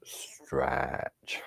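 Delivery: soft unvoiced whisper. Real, not acted. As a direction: breathe the word out. Quiet stretch cue mid-lesson.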